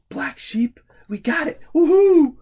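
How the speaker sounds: triumphant whoop over the right answer; excited cheer. Real, not acted. Game success.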